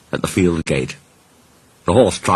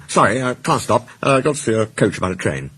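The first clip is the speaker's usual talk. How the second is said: hurried; 4 semitones higher than usual. A narrator's reading. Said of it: "he went on hurriedly".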